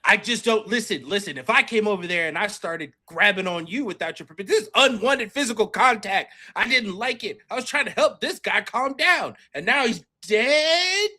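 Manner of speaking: loud, high wounded protest; hammed-up loud outrage; loud indignant rant. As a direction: defend yourself with offended pride. In-character indignant complaint.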